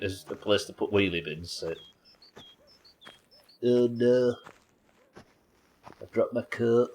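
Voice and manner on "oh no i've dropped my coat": put-on broad Hull accent, raised a touch. Streamer joking for viewers about a dropped coat, hammed-up Hull accent. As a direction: play up the Hull vowels in a mock lament. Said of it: "how to speak in a Hull accent".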